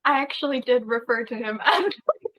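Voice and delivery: real laughter breaking into the words; laughing hard. Real, not acted. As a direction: talk through a genuine laugh, pitch lifted. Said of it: "I'm laughing so hard".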